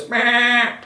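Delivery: high, loud sheep bleat; sheep baa. A narrator's reading. Animals chase the farmer.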